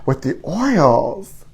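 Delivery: 1 insinuating. Pretend. Slow teasing aside.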